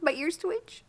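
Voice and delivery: high, cutesy bunny voice; frightened prey animal. Pretend. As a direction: squeak it like a nervous bunny. Playing a scared bunny.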